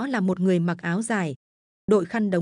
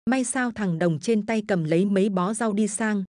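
ordinary delivery, nothing marked

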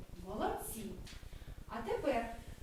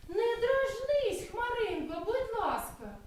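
pleading bunny; 7 semitones higher, slower than usual. Acted. Hurt bunny pleads with cloud.

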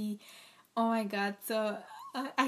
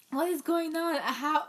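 higher and louder, a real jolt of disbelief; shocked outburst; 5 semitones higher, 4 dB louder than usual. Real, not acted. Fan stunned by a surprise release, shocked outburst. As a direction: blurt it out stunned.

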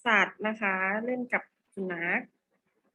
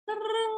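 high, drawn-out sound effect; sing-song transition noise, dropping at the end; imitated "tueng" effect; 12 semitones higher, slower than usual. Shown as a demonstration. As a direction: voice a long high-pitched "tueeeng". Imitated noise while switching slides.

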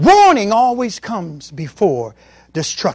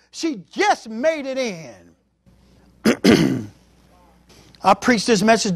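normal talking voice

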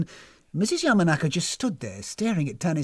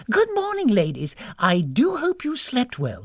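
high, cheery greeting; beaming hostess; 9 semitones higher, 4 dB louder than usual. A narrator's reading. Hostess greeting her guests at morning, beaming. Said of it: "she beamed".

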